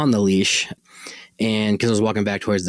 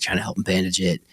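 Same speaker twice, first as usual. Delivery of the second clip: ordinary delivery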